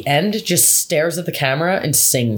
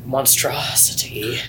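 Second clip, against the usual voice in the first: whispered; 6 semitones lower, 4 dB quieter, much slower than usual. Real, not acted. Dramatic verdict on the CGI.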